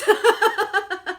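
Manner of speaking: high loud burst of laughter. Real, not acted. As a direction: laugh out loud at your own anger. Laughing at her own rant.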